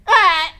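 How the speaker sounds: loud high yelp as she falls; short shocked shout; falling scream. Acted. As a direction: cry out mid-fall. Falling off the ladder again.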